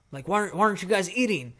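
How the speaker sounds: heated, quick-fire mock scolding; intimidating dad. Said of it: "'cause they're so scared".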